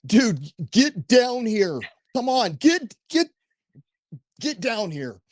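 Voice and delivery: mock-scold the son down off the wall; chewing him out. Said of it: "I chew him out".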